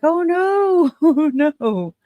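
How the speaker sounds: high, loud retold "oh no"; mock dismay. Streamer tells viewers how they reacted to dozing off, high mock dismay. Retelling own reaction on waking.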